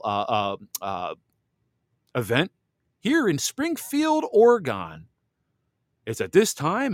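mock him pitching the paid event; mocking imitation. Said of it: "he's saying".

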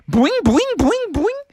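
high, springy boings; boing like a spring, high up; bouncing noises. Acted. Imitates bouncing.

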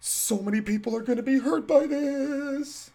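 slow, drawn-out mock worry; mock dismay. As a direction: act out the overdone concern, slowly. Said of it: "You go like".

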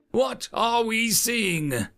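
high, slow, projected question; quizzing instructor. A narrator's reading. Educator quizzing cadets from beneath the screen, high slow question. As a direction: put the question slowly to the whole class.

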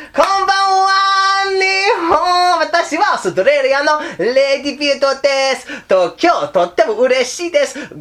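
very high, bright falsetto woman's voice; man playing a cute Japanese lady, way up high. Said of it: "a little Japanese lady voice".

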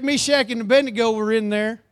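ordinary delivery

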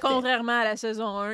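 ordinary delivery

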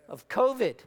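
ordinary talk

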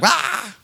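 a high joyful cry, hands up; joyful whoop. Retold or imitated. Pastor recalls breaking into worship with a high whoop. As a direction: let out a high wild whoop of joy.

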